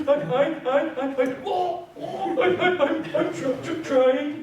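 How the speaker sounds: high, sobbing stutter played for laughs; mock crying stammer. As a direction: stammer through fake tears, pitch way up. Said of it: "so I'm crying and dry heaving".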